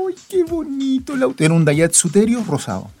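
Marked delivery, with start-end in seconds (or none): 0.1-1.4 gushing admirer. Retold or imitated. Imitating people admiring pink car.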